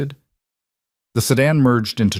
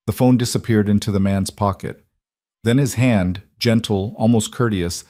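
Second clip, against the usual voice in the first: ordinary delivery, nothing marked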